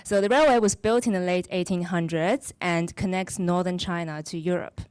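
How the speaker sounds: usual speaking voice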